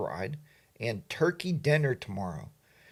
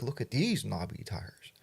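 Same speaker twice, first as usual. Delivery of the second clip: whisper; 6 semitones lower, 9 dB quieter than usual. Retold or imitated. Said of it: "he'll send me a picture and be like".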